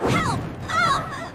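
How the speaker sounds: high, loud cry of alarm; panicked cry for help. Acted. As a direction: cry out as you fall. Falling, calling for help.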